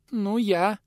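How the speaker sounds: put-on high squeaky boy's reply; squeak. A narrator's reading. Eldest boy answers the pensioner, high squeak. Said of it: "пискнул в ответ тот".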